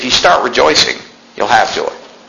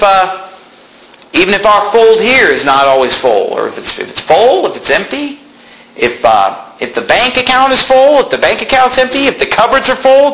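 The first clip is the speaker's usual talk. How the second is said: high, climbing, near-shouted preaching; preaching at full force; 9 semitones higher than usual. Acted. Preacher to church about full or empty cupboards, climbing to a cry. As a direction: drive the list higher and harder each line.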